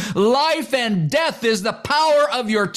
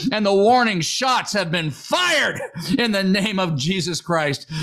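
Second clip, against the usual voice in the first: ordinary talk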